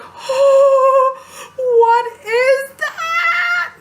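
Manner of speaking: high, loud, drawn-out mock wail; hammed-up horrified cry; horrified shriek. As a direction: shriek it in exaggerated horror, stretching the words. Acts horrified look in mirror.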